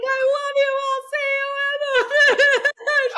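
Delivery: high, loud tearful wail; imitated sobbing. Runner to her dad as she passes, mock sobbing. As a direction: wail it back through mock sobs. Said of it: "and I'm sobbing".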